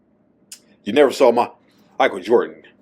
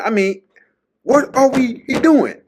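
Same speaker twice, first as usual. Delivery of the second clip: indignant Isaiah Thomas; 6 dB louder than usual. Retold or imitated. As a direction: plead in disbelief, voice up high. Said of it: "you remember what he said".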